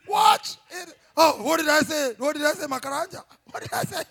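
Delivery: loud confused waking husband; mock startled husband; startled awake. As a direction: jolt awake and stammer.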